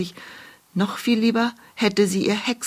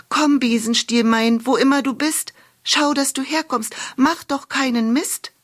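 calling out; 7 semitones higher than usual. A narrator's reading. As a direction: call the lost broom through the woods. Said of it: "rief sie".

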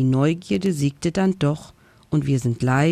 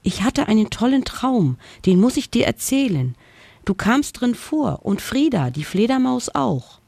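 higher, eager little ghost bursting to tell; excited outburst; 6 semitones higher than usual. Acted. Little ghost tells his dragon friend about a dream, excited. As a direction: spill the dream out, bubbling over. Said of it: "mit einem aufregenden Wortschwall".

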